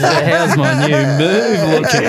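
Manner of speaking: teasing a mate by imitating his bragging; mock Shooter brag. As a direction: ham up a cocky student fishing for praise. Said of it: "It's just".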